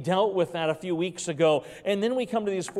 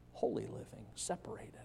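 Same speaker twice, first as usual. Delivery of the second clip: hushed; 6 semitones lower, 13 dB quieter than usual. Acted. Sermon point trailing to hush.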